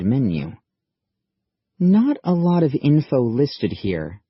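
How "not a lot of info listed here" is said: slow, scornful, raised pitch; derisive sneer. A narrator's reading. Maddie mocking the thin government website menu, derisive. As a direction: sneer at the meager list. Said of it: "sneered Maddie derisively".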